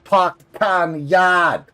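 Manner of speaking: loud Boston accent with long, open A's. Shown as a demonstration. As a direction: stretch car and yard like a Bostonian. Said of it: "that kinda thing like that".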